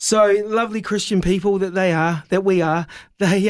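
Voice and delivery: normal talking voice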